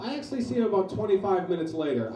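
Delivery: usual speaking voice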